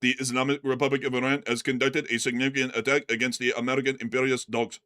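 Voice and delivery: low monotone robot voice; flat robotic spokesman read. Retold or imitated. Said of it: "sounds like a robot".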